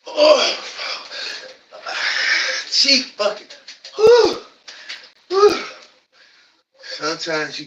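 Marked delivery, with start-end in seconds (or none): 0.1-5.6 high loud strained whews; strained cries. Real, not acted. Pushing through the last reps, loud strained cries. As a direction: cry out through the burn. Straining hard during exercise.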